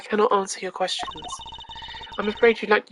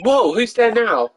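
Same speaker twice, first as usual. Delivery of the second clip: mock startled; 4 semitones higher, 6 dB louder, slower than usual. Startled greeting in game.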